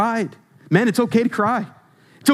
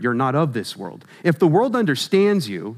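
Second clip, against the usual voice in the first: ordinary talk, nothing marked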